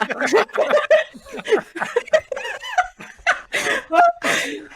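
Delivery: long high-pitched laugh; helpless, drawn-out laughing fit; laughter. Real, not acted. Laughing at the clown remark.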